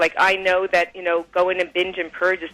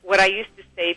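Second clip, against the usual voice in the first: usual speaking voice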